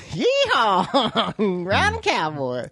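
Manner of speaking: hammed-up Texas cowboy holler, laughing; corny Texan cowboy voice between laughs; Texan cowboy accent. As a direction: do a goofy Woody-style Texan. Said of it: "I sounded like Woody from Toy Story".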